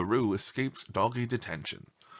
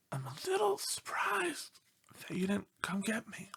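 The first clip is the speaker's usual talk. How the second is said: feeble sickbed whisper; a dog faking illness, whispering; pitiful whisper; 10 semitones higher, 8 dB quieter than usual. Acted. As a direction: whisper it as if too weak to speak. Sick dog pleads in letter.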